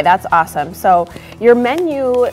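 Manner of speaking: ordinary delivery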